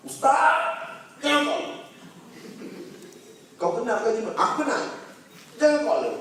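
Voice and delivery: high, pleading jinn voice; frightened jinn. Retold or imitated. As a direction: beg him not to phone. Jinn begs him not to call.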